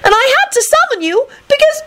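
very high, frantic wail; piercingly high plaintive voice. A narrator's reading. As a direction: wail your excuse way up high. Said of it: "Rosie wailed".

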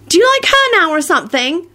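very high-pitched nosy question; tactless blurt. A narrator's reading. Ginny to Neville about liking Luna, tactless. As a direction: blurt it without thinking. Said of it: "Ginny blurted out tactlessly".

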